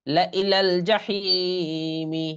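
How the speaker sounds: slow, drawn-out Quran chant; Quranic recitation. Shown as a demonstration. Teacher recites the joined word for the students after spelling. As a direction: recite it slowly and sustain the vowels.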